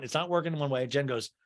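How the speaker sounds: ordinary delivery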